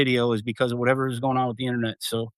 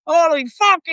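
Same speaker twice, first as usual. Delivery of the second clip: imitating Irish accent; 4 dB louder than usual. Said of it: "with that Irish accent".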